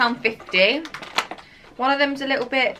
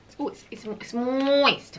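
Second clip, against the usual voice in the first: chewing while talking; talking around cupcake bite; mouth full; 4 dB quieter than usual. Real, not acted. Seen 0: Woman holding cupcake, lips pursed as if chewing between words.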